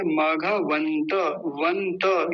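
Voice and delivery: slow मघवंत, वंत with an added final "a", "vanta"; wrong pronunciation. Shown as a demonstration. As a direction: draw out a full vowel after त in मघवंत, वंत. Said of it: "ये गलत है".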